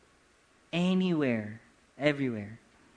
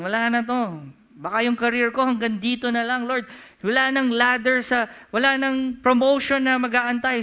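louder, higher complaint to the Lord; discouraged believer's plea; 7 semitones higher, 8 dB louder than usual. Acted. Worried worker telling the Lord his career is stuck, loud complaint. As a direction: voice someone stuck in a dead end, pleading loudly.

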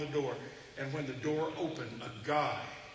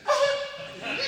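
much higher, louder squeaky yes; a terrified little yes; nervous squeak; 8 dB louder than usual. Retold or imitated. Nervous preacher at giant's door.

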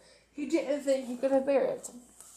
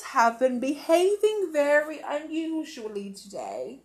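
crisp TV news read; newsreader voice; 3 semitones higher, 4 dB louder than usual. Acted. Storyteller voicing the TV newsreader's bulletin.

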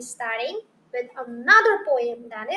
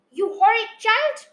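louder, higher telling-off; mild storybook scolding with raised voice; scolding cry; 6 semitones higher, 5 dB louder than usual. A narrator's reading. Mother rebuking her child, scolding cry. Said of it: "my mother cried".

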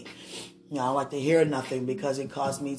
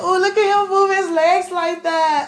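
squealing excitement; 13 semitones higher, 9 dB louder than usual. Retold or imitated. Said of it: "I was like".

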